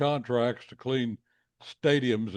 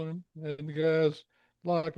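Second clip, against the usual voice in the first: normal talking voice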